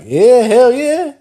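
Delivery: high-pitched, drawn-out hyped-up yeah; excited yeah. Retold or imitated. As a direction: act out an eager, slow-rolling agreement. Acting out his eager response in story.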